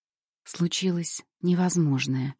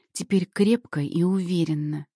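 ordinary delivery

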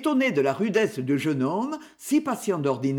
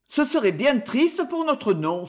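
higher-pitched, gently sorrowful lament; mild sighing regret; 6 semitones higher than usual. A narrator's reading. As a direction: sigh it lightly, with regret. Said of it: "soupira Luc". (soupir attristé, discret)

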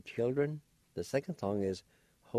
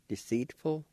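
usual speaking voice

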